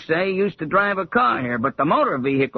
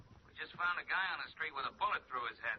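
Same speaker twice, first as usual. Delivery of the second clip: tinny telephone voice; a police sergeant calling on the line; gruff sergeant through a phone receiver; over the phone; 8 dB quieter than usual. Acted.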